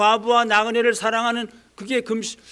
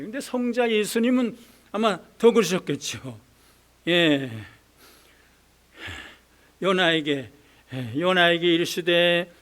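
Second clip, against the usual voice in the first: ordinary delivery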